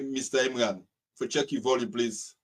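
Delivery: ordinary delivery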